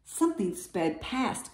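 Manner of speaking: ordinary delivery, nothing marked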